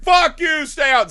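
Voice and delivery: high, loud mock shouting; angry retort. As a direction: bellow it like a landlord slamming the door. Acting out landlord's angry retort.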